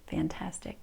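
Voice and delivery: whispered praise; whispering. Shown as a demonstration. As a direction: breathe the word softly. Whispered praise during practice.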